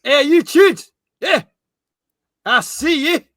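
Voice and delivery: high, loud put-on Scottish accent. Shown as a demonstration. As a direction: call out in a hammed-up Scottish accent. Host continues the Scottish impression.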